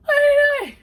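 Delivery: very high, loud cry of alarm; mock startled shriek. Roommate catches stranger crawling onto his mattress, startled shriek. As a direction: shriek it in hammed-up shock. Startled man finds her in bed.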